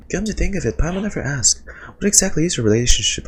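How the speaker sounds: chirpy high little companion; bright squeaky fairy sidekick; high Paimon voice. Acted.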